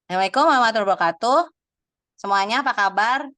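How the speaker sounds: fast, loud MC greeting rushed through; rattled-off greeting. Shown as a demonstration. MC opening an event to the audience, fast and loud. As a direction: rattle off the salam like an overexcited host. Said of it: "Ya, dijempreng".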